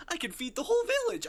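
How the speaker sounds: fast, high, loud through laughter; giggling fast and loud; laughing. Real, not acted. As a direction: blurt it out cracking up. Laughing at own sack of rice joke.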